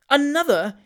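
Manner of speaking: high, loud outraged echo; shocked exclamation. A narrator's reading. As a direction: echo the word in jealous shock. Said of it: "ejaculated the spinster".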